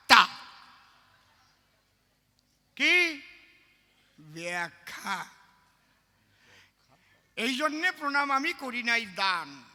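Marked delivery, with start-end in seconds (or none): none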